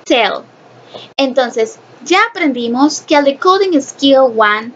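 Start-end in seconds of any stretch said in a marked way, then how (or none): 0.0-0.4 "Tail" set apart as one syllable; pronunciation demo. Shown as a demonstration. Teacher repeating the second syllable for the class, demonstrating "Tail". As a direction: model "Tail" on its own for learners. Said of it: "Entonces sería".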